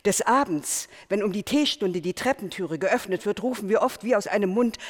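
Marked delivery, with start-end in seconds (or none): none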